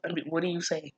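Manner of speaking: normal talking voice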